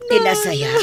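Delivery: high, loud dismay; high loud shocked outburst. Acted. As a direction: cry out in disbelief. Mother shocked at news.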